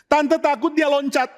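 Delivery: high, worried plea; acted motherly alarm, pitched right up; frightened. Mother telling her son's friends he may jump, acted fright.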